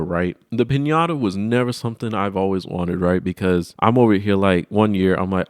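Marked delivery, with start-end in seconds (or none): none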